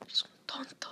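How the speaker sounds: whispered "you're a fool"; whispered insult. Acted. Whispering an insult at the friend. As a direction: hiss it under your breath.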